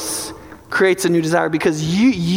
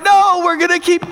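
insisting shout; 11 semitones higher, 4 dB louder than usual. Acted. You pushing your body on.

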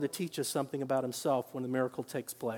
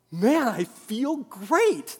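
slow, louder, higher happy exclamation; joyful quote; 5 semitones higher, 6 dB louder, much slower than usual. Retold or imitated. Scott tells the pastor after the prayer, slow and joyful. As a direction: savour the joy of it.

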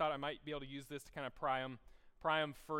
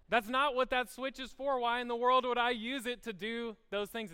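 higher, louder, hammed-up indignation; mock outrage; 8 semitones higher, 8 dB louder than usual. Pastor scolding himself, hammed-up indignation. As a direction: act appalled at the idea.

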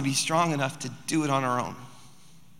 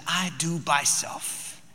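put-on softer, very slow toddler quote; imitating toddler; 5 dB quieter, much slower than usual. Two-year-old opening the fridge herself, softly imitated. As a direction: stretch it out softly like a little kid. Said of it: "She said".

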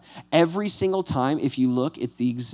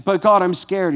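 higher, louder mock-scared plea to God; a hint of whiny fear, pitched up; 5 semitones higher, 6 dB louder than usual. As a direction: play a frightened Moses begging. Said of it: "And he's like".

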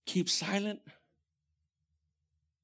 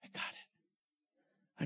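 slow soothing shush, softer; calm them down with a slow hush; 10 dB quieter, much slower than usual. Retold or imitated. God hushing his frantic people, soft slow shush. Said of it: "God says".

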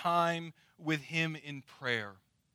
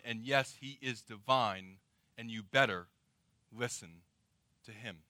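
correcting Peter; 4 semitones lower, 3 dB louder than usual. Acted. God sets Peter straight about his one son, stern correction. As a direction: start loud, then drop low and measured. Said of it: "He was correcting Peter a little bit".

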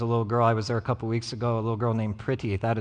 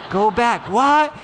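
put-on high, loud little-boy "what"; retold kid yell; 11 semitones higher, 10 dB louder, much slower than usual. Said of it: "It's like".

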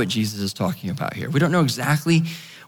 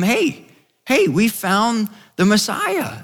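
higher-pitched, lightly acted excitement; eager announcement; 8 semitones higher, slower than usual. Philip telling his friend the news, mildly excited. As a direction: call a friend over with big news.

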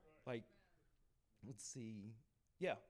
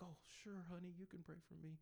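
higher, softer voice for a kindly lady; kindly lady, retold; 5 semitones higher, 7 dB quieter, faster than usual. Lady in pain accepting a prayer, soft and kindly. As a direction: accept gently, as the lady in the story.

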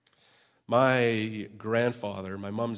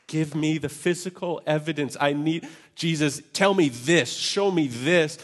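higher, louder demand; demanding doubter; 4 semitones higher, 7 dB louder than usual. Retold or imitated. Congregation's doubting voice asking Jesus for evidence. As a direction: demand proof from God. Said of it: "and we say".

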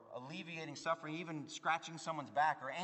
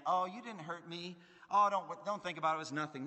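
loud, high, brushing it off as nothing; hammed-up airy dismissal, as if nothing happened; mock breezy denial; 5 semitones higher, 8 dB louder than usual. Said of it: "It's not like ostrich, head in the sand".

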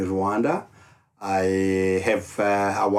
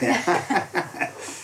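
real chuckle; 9 semitones higher than usual. Real, not acted. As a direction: laugh after his own joke. Laughs after own joke.

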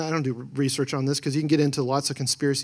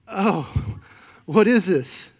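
surprised; 3 semitones higher, much slower than usual. Retold or imitated. Retold counselor puzzled reaction.

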